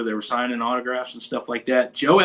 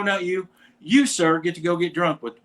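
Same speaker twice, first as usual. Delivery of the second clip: ordinary delivery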